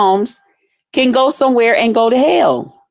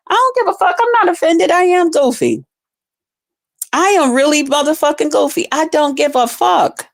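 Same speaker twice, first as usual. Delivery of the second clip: higher, defiant and proud of being goofy; 5 semitones higher than usual. Real, not acted. Streamer to critics, owning being goofy, defiant.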